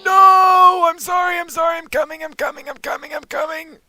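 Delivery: high, hurried panic dying away; frantic apology. Pretend. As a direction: start on a panicked shout, then trail off. Panicked rush to the baby.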